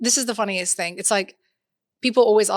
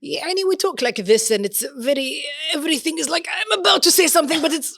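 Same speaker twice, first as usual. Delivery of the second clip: higher, hammed-up Emirati accent; 6 semitones higher, 4 dB louder than usual. Shown as a demonstration. Making fun of dad on request, exaggerated Emirati accent. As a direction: mimic an Emirati accent as if words struggle out. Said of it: "Do Emirati".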